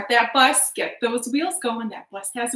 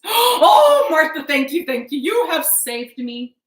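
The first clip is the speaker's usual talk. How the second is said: loud, high gasping gratitude, easing down; overjoyed relief; 5 semitones higher, 9 dB louder than usual. Acted. Husband to wife who saved him, overjoyed. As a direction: burst out high and loud with thanks.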